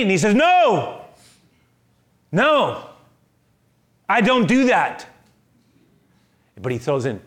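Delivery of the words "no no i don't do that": high, loud no; loud recoiling refusal. Acted. Peter recoiling at the sheet, loud refusal.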